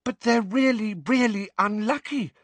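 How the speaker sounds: high-pitched alarmed schoolgirl. A narrator's reading. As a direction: protest in high, worried tones. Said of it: "looking alarmed".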